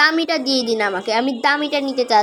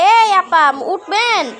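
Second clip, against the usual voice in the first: loud call; 2 semitones higher, 4 dB louder, slower than usual. Acted. Calling out to sleeping shopkeeper.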